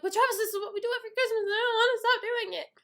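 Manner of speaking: high-pitched, breakneck pleading; whiny protest. Retold or imitated. Sister arguing with brother on Christmas morning, whiny protest. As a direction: whine it out in one rush.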